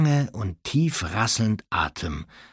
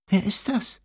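put-on second startled girl; 11 semitones higher than usual. Ginny bumps into Hermine in the dark, high unsure question.

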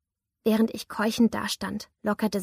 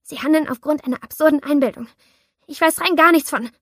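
rising panicked protest; 6 semitones higher, 10 dB louder than usual. A narrator's reading.

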